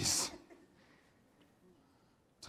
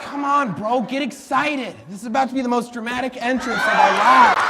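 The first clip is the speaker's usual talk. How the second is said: excited; 4 semitones higher, 5 dB louder than usual. Acted. Hyping the entrance.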